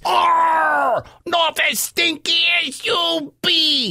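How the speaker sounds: high, defiant pirate retort; growling pirate. Acted. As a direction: throw an Arr back at the giant. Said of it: "growled Pete".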